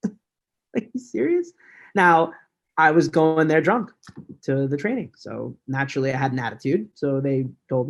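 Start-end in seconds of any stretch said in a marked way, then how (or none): 0.1-1.5 high, slow, laughing disbelief; a stunned, half-laughing question; shocked disbelief. Retold or imitated. Fired the day after graduating, disbelieving laugh.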